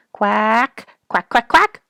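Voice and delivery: high, loud rising quacks like a mallard; loud duck quacks. A narrator's reading. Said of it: "quacked loudly".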